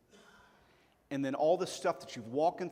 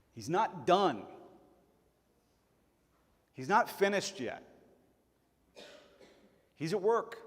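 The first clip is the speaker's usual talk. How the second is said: louder, rising preacher's emphasis; preaching at full force; 4 semitones higher, 4 dB louder than usual. Acted. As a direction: drive the point home to the congregation.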